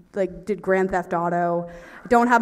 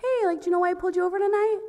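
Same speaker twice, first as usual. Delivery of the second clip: high, quick, friendly officer impression; nice-guy cop; 10 semitones higher, much faster than usual. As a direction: play a chirpy, polite policeman. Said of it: "he's like, really nice guy".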